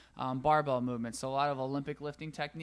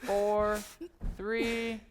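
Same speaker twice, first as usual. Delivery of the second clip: counting down; 6 semitones higher, 6 dB louder, much slower than usual. Real, not acted. Coach counts down workout end.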